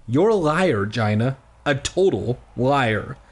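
usual speaking voice

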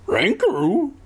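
high cartoon dog voice; goofy dog-speak, rising; talking dog. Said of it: "as if to say".

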